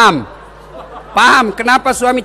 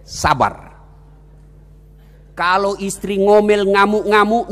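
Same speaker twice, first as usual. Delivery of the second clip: ordinary talk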